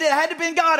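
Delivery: high, loud awed wife voice; wife realizing it was God; wife's amazed conclusion. Retold or imitated. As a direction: exclaim it in her astonished voice. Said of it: "She said".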